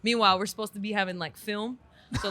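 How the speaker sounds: ordinary talk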